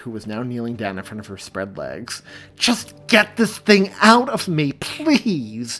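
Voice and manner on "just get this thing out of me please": much higher, louder, slow pained begging; desperate pleading. A narrator's reading. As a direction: beg through labor pain. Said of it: "she implored".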